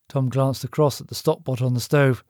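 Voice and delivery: usual speaking voice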